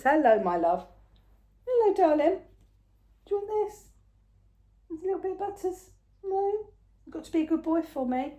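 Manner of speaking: very high, sing-song coaxing. Real, not acted. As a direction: coax a little one with a treat. Coaxing the pet mid-filming.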